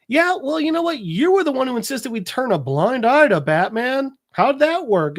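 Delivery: high-pitched, challenging comeback; mock-indignant imitation of an arguing man; retold argumentative retort. Acted argument with Gordon.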